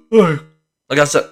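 slow, sighing yawn. Real, not acted. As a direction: yawn through the words. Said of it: "'cause I keep yawning".

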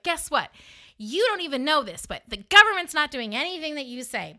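taunting revelation. Retold or imitated. Woman adding insult to injury in fight, taunting. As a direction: rub it in triumphantly.

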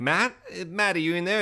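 slightly high mock searching call; tentative calling. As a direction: imitate someone calling into an empty place. Acts out woman searching for partner.

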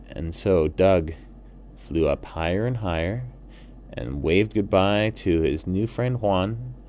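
plain reading voice

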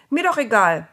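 loud, dismissive retort; determined. A narrator's reading. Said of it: "Sarah klang ganz entschlossen".

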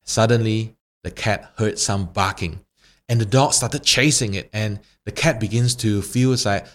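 ordinary talk

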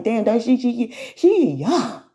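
high, amazed mock fan voice; imitating an amazed fan. Fans seeing her in person, in an amazed high voice. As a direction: gush like a stunned admirer. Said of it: "y'all be like".

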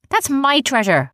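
high, loud and drawn out; loud indignant outburst. A narrator's reading. Captain Seamus overhears the pirates and forgets hiding, loud and indignant. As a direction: blurt it out indignantly, far too loud. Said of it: "so loud the two pirates turned their head".

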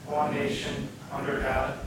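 distant group recitation in hall; meeting room mic. Real, not acted. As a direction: crowd reciting far from mic.

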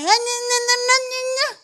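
put-on high whiny baby babble; high-pitched toddler fussing; frustrated baby. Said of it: "You ever seen the babies going".

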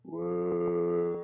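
soft, low, half-hearted woo; a feeble, quiet cheer for yourself; unenthused cheer. Real, not acted. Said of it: "you sound so enthused about your own existence".

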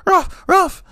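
high yipping ruff ruff; high little yap; yappy dog bark. Retold or imitated. Imitating the dog's bark.

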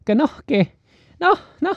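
put-on high, sing-song coastal Mexican accent; mock costeño accent. Comedians mocking the coastal accent, in a high costeño accent. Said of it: "se burlan mucho del acento costeño".

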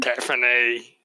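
a light Australian lilt on Tiffany; Australian accent. Shown as a demonstration. Asked to replicate how Barbara said the name, a mild Australian accent. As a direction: say Tiffany the way an Australian neighbour would. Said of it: "sounds like her husband, who's Australian".

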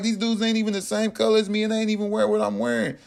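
fast, high-pitched objection; acted-out incredulous complaint, rushed and high; retold indignant protest. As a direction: rattle it off high in disbelief.

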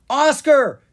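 high, loud alarm call; yelled. Acted. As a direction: yell the name in alarm. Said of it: "Drake yelled".

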